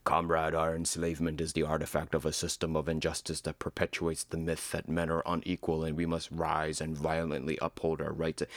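low, fast, earnest comrade voice; hammed-up activist manifesto; mock revolutionary voice. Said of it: "passing out a humanitarian leaflet going".

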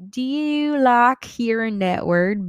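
high, slow, teasing question; playful put-on voice. Asking listeners about the word boyfriend, playful voice.

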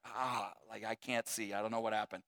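soft, low, dazed mumble; like you just took a headbutt; hushed stunned father. Retold or imitated. Dazed after headbutt to face.

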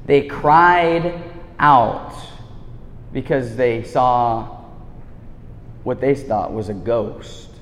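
They cried out drawn out slowly, cried stressed; slow emphasis. Shown as a demonstration. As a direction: linger on each word of They cried out. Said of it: "Can you guys circle that word?".

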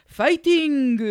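put-on loud, high Korean-accented "Fighting"; Korean-accented cheer "Fighting" to egg someone on. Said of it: "in his Korean accent".

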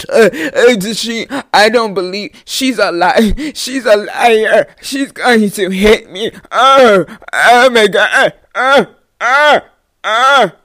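hammed-up loud crying, words dragged out; mock bawling. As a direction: imitate a hysterical sobbing accuser, gulping between words. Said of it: "I'm talking about fucking crying".

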